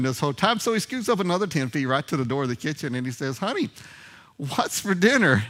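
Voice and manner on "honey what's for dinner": high, slow call of honey, stretched out; calling out loud. Retold or imitated. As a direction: call to her across the kitchen, louder now. Husband calls from kitchen door.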